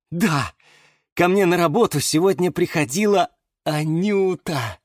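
glowing with happiness. A narrator's reading. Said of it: "засиял ещё сильнее".

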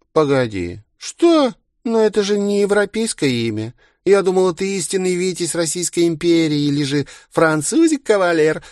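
very high, gushing, then shocked; swoon, then squeal in surprise; giddy party girl. Acted. Староста восхищается, потом удивлена. (восторженная, потом изумлённая девчонка)

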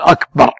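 أكبر short, rising, no lengthening; correct akbar. Shown as a demonstration. Teaching the caller, short أكبر. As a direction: clip أكبر, don't stretch it. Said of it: "لا يمدها".

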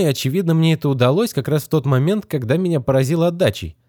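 put-on high, earnest young boy reasoning; boy working it out aloud; boy's voice. A narrator's reading.

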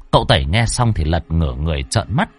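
ordinary delivery, nothing marked

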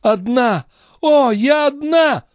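high, despairing outcry; frantic enraged wail; convulsive fury. A narrator's reading. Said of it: "с судорожной яростью вскричала девушка".

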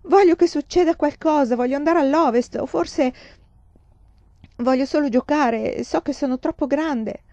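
high-pitched, pleading frustration; exasperated. A narrator's reading. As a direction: pour it out, fed up with everything. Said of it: "disse Laura esasperata". (sfogo esasperato)

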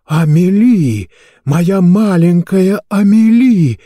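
high, loud, drawn-out call; slow, swelling cry of longing; overcome mother's cry. A narrator's reading. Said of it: "Хриплый шёпот раздался из уст мадам Экс".

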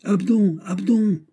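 very high calling-out; bellowed call. A narrator's reading. Planchet calling his boy from the window, bellowed call. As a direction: call the name out to someone far off. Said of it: "vociféra Planchet par la fenêtre".

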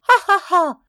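high, loud acted laugh; read-aloud ha-ha-ha of mockery. A narrator's reading. Marguerite laughing at Léon's excuse, mocking laugh.

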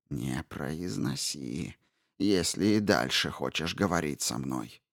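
low hissed warning, quiet but furious. A narrator's reading. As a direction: hiss it like a coiled panther. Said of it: "прошипела вдруг Мап".

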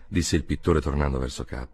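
ordinary delivery, nothing marked

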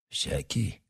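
low, soft doubtful echo; quiet questioning echo. A narrator's reading. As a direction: echo the word back, unsure. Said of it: "уточнил Одноглазый".

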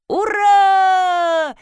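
high, loud, drawn-out cheer; long whooping shout; triumphant yell. A narrator's reading. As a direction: yell it out in victory. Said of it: "завопила Ирина Леонидовна".

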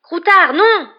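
high, loud cry of panic; desperate high-pitched call; alarmed shout. A narrator's reading. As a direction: yell after a runaway pet. Rat escapes, owner cries out.

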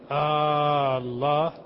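"آه الله" stretched slowly with a rising question tune; drawn-out questioning Allah. Shown as a demonstration. Teacher showing the wrong drawn-out hamza. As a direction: drag the hamza of "آه الله" long and lift it like a question. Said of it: "صار استفهام".

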